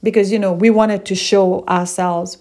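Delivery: ordinary talk, nothing marked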